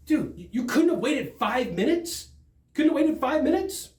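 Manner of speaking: high rising questions; retold indignant disbelief, pitch climbing to the end; incredulous. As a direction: question them in mounting disbelief. Retold disbelief over flat tire excuse.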